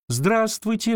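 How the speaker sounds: put-on high bright little-girl greeting; little girl says hello; girl's voice. A narrator's reading. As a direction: pitch up like a small girl.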